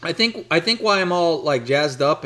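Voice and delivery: jazzed up on coffee; too much caffeine. Real, not acted. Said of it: "I had two cups of coffee today".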